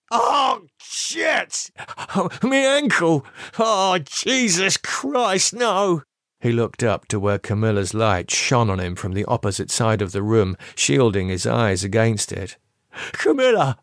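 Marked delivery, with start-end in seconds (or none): 0.1-6.0 high, strained moaning voice; cry of pain. A narrator's reading. Lee injured on the floor, crying out in pain. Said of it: "He cried out in pain". 13.1-13.8 high pleading call. A narrator's reading. Lee calling to Camilla for help, high pleading call. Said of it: "he called out".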